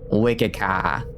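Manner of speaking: Boston accent with the R dropped in "car"; amateur stab at a Boston accent; attempted Boston accent. Shown as a demonstration. Streamer copying Boston-born grandmother, rough Boston accent. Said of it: "I can't do a Boston accent".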